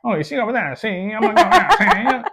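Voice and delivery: 1920s gangster voice, high and loud; old-timey Al Capone newsreel voice; mid-Atlantic gangster accent. Retold or imitated. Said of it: "It's like a mid-Atlantic accent".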